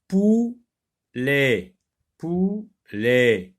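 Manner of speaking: "Poulet" broken into pou... let; split into syllables. Shown as a demonstration. Teacher locating the sound for pupils, split into syllables. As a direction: separate "Poulet" into two drawn-out beats.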